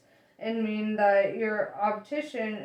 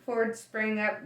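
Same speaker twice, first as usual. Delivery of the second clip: ordinary delivery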